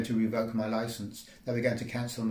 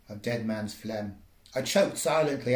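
ordinary delivery

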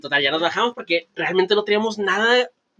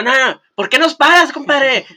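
raised, louder indignant challenge; mock protest; 6 semitones higher, 4 dB louder, slower than usual. Drunk friend challenges the police who stopped them, mock protest. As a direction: play a friend challenging the officer.

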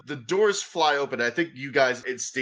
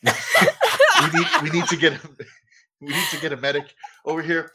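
stammering agent dissolving into laughter; scared agent breaking up laughing; panicky agent; 3 semitones higher, 4 dB louder than usual. Acted. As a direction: babble fast, laughing through it.

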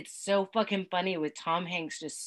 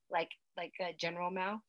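ordinary talk

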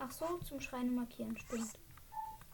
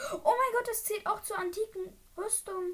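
surprised; 8 semitones higher, 4 dB louder than usual. Real, not acted. Surprised by armor set bonus.

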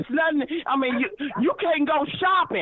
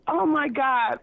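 usual speaking voice

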